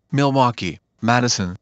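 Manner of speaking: normal talking voice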